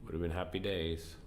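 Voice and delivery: ordinary talk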